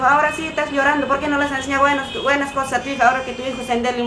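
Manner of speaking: high, fast scolding jab of a stranger; mocking reproach. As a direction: throw the blame at her, fast and high. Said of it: "una señora me dijo". (reproche burlón, agudo y rápido de una desconocida)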